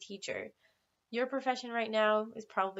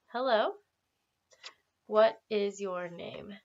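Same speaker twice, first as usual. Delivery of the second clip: slow, clear teacher modelling; slow model phrase; slower than usual. Shown as a demonstration. Teacher modelling a question slowly for students. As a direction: spell it out slowly for the class. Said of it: "repeat that with me".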